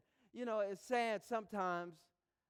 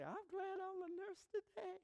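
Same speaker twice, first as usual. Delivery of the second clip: higher, softer playful nurse voice; voicing the nurse; 6 dB quieter than usual. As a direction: lightly play the cheerful nurse. Nurse quoted in story.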